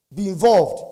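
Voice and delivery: loud, punched exhortation; emphatic urging. Real, not acted. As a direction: drive it home to the audience. Urging the audience, then repeats softer.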